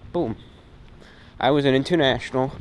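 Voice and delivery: usual speaking voice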